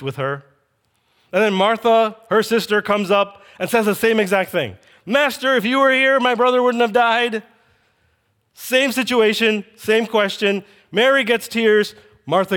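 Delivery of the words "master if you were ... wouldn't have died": high, insistent mock lament; acted reproach. Mary to Jesus about her brother, acted reproach. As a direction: push it high as a grieving reproach.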